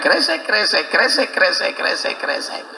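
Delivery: fading imitated kresek swish; sandal shuffle sound. Preacher imitating Bilal's sandals in paradise, fading swish.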